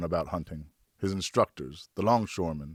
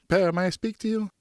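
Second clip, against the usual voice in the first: put-on much higher, eager young son; boy's voice; 11 semitones higher than usual. Son asks his father for a moment, in a high boyish voice. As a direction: voice the boy hopeful and polite.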